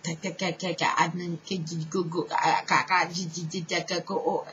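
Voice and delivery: choppy repeated ka syllables; imitated stutter tongue. Showing the audience the trance stutter, broken choppy syllables. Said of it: "It was like a".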